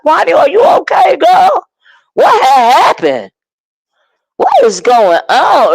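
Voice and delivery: high-pitched, hammed-up worry; acted shocked check-in; alarmed concern. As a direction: call out in mock alarm, drawing out the questions. Acts out alarmed concern for Kwani.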